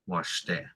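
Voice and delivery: Washte said softly, stress on TE; second-syllable stress. Shown as a demonstration. Teacher repeating the correction, stress on the second syllable. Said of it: "It's washte".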